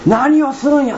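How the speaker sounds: a preacher reliving a dream, yelling to stop it; retold shout. Preacher recalling his dream, crying out high in protest. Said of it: "って叫んでるんですよ".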